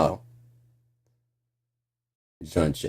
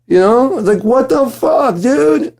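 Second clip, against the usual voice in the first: confused mock. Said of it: "imitating Mitch confused".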